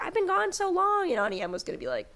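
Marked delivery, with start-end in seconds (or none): 0.0-1.1 mock Dorothy. Dorothy voiced in a retelling.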